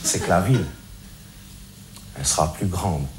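calmed-down sincere. Acted. Reformed street guy shares his wise message, calm and low. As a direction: settle in and preach calmly. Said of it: "je me suis calmé".

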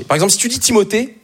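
normal talking voice

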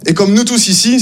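normal talking voice

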